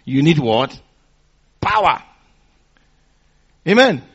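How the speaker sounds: ordinary talk, nothing marked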